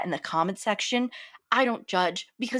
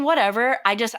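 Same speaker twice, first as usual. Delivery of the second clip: normal talking voice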